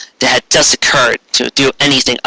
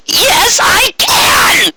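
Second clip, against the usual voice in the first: much higher defiant shout; Dizzy shouting; much slower than usual. A narrator's reading. Dizzy defying Trixie while pinned, high shout. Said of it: "Dizzy shouted".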